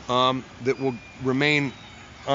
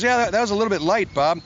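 light teasing producer; 7 semitones higher, faster than usual. Retold or imitated. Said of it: "He goes".

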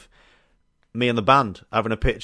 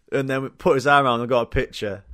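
ordinary talk, nothing marked

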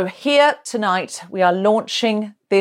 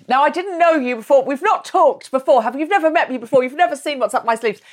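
light mock magician patter, a bit higher; card magician patter; 7 semitones higher than usual. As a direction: tease like a stage magician with a deck. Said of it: "I sounded like a magician".

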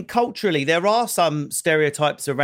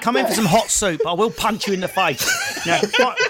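mock sweet threat; 5 semitones higher than usual. Mock woman offering soup, threatening.

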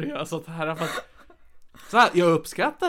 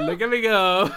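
louder, hammed-up self cheer; mock cheer; 6 dB louder than usual. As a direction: cheer yourself on, tongue in cheek. Mock self-congratulation in English.

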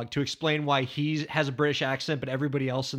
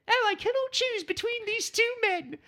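high, hammed-up posh English voice; mock British accent. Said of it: "Renee Zellweger and British".

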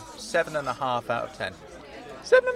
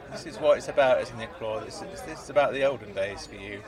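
ordinary talk, nothing marked

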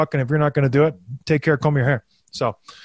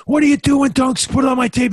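mock bench yell; 9 semitones higher than usual. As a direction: shout it up high like a furious star winger. Kaner yelling at Dunks on bench.

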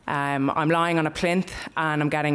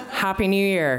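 higher-pitched mock Dublin Skerries accent; 4 semitones higher than usual. Stranger greeting her at the party, Dublin Skerries accent. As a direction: imitate him in broad Dublin Skerries. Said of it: "in his Dublin Skerries accent".

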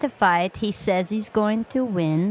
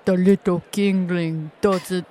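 quoted threat. Retold or imitated. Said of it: "He said that".

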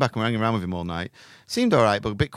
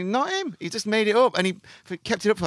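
much higher, gleeful realization; excited reveal; 11 semitones higher than usual. Real, not acted. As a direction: squeal out the punchline in delight. The fake-prince reveal.

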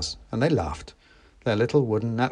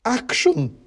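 high, louder gleeful shout; gleeful cry; 13 semitones higher, 5 dB louder than usual. A narrator's reading. Werner crying out behind the narrator, high and gleeful. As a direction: Werner can't wait to get going. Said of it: "cried Werner from behind me gleefully".

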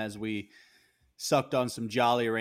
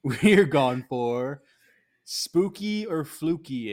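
slow, dramatic spooky announcement; drawn-out segment name, hammed up; segment title reveal; 5 semitones higher, 6 dB louder, much slower than usual. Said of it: "announcing the segment name".

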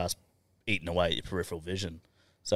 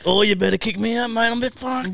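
mock drunk; 8 dB louder than usual. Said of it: "they pretended to be fucking off their face".

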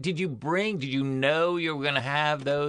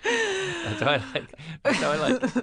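laughing; 10 semitones higher than usual. Real, not acted. Laughing at own joke.

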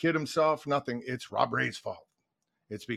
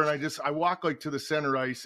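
normal talking voice

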